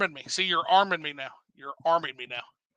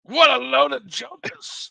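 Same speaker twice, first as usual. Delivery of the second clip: higher, slow, put-on bad British accent; hammed-up fake British accent, drawn out; terrible British accent; 7 semitones higher, 3 dB louder, slower than usual. Mocking a game's bad British accent, fake British accent. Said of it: "That is a terrible fucking British accent".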